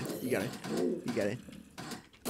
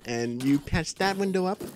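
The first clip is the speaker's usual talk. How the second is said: ordinary talk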